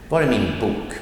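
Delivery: "var är min" quick and light, "bok" emphasized; stress on bok. Shown as a demonstration. Teacher repeating the example, end stress on "bok". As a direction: land on the noun "bok" at the end. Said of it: "the emphasis comes at the end on the noun".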